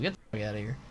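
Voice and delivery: ordinary talk, nothing marked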